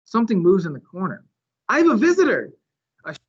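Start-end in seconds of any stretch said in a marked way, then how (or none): 1.7-2.5 much higher, delighted exclamation; thrilled little gasp of joy; excited. A narrator's reading. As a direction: burst out with glee at a visitor. Delighted at a beetle.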